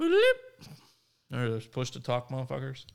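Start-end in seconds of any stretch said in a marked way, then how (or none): 0.0-0.3 high, loud electronic bloop; imitated phone chirp. Friend recalling old Nextel phones, imitated high chirp. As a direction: mimic a push-to-talk alert.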